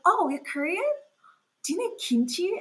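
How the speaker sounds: high-pitched eager questions; mock excited interest. Strangers learning she is Korean, mock excitement. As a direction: imitate a stranger's delighted discovery.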